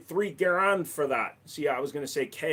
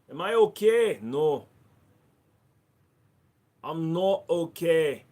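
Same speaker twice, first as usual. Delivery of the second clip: a hammed-up Scottish accent, louder and higher; put-on Scottish accent; 3 semitones higher, 7 dB louder, slower than usual. Checking on himself for chat in mock Scottish accent. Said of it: "I'm gonna do Scottish now".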